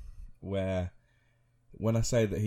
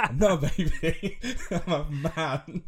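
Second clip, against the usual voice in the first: higher, slow, laughing whiny protest; mock childish protest; 7 semitones higher, 5 dB louder, slower than usual. Son protesting to his mum he is a man, laughing whine. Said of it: "I'm like".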